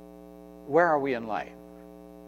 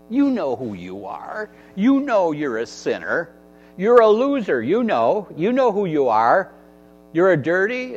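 louder, higher taunting accuser; Satan's accusing voice; 4 semitones higher, 5 dB louder than usual. Acted. Satan whispering lies to the believer, loud mocking accuser. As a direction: jab each insult at the listener like the devil. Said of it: "that's what Satan does to us. He says".

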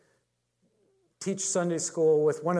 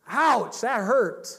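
a bright, loud, raised "ouch" of welcome pain; glad ouch; 6 semitones higher, 5 dB louder, much slower than usual. Retold or imitated. Preacher voicing a believer stung by Scripture, gladly. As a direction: yelp it higher and louder, almost happy about it.

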